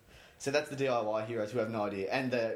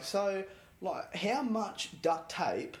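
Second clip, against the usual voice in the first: higher, slow naive question; clueless DIY guy; 6 semitones higher, much slower than usual. Retold or imitated. DIY hero asking the counter guy, naive and slow. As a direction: play it dim and earnest. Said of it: "they go, like".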